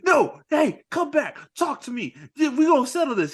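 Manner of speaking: high, insistent mock demand; urgent calling back. Mike calling after Marcus walking away, urgent imitation.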